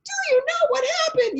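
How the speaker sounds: high, loud, rushed and near tears; a gushing, tearful rush of excitement; excited blurt. Retold or imitated. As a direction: burst out with the news. Eager retelling of her own outburst.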